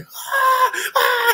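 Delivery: very high-pitched mock wailing; imitated screams. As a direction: mimic a brother's screams during a beating. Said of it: "I was in my room like".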